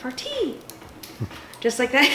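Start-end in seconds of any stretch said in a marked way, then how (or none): 0.0-0.6 high, soft "Farty" with a crisp T; pinky-up "Farty", pitched up and delicate; mock-dainty. Shown as a demonstration. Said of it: "with your little pinky in the air".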